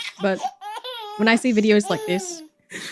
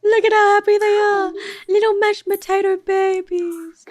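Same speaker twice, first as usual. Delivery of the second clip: high, loud, slow gushing; overwhelmed by cuteness; 10 semitones higher, 5 dB louder, slower than usual. Real, not acted. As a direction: gush over the puppies. Gushing over golden puppies.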